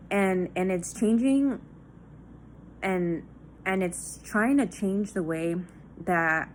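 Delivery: ordinary talk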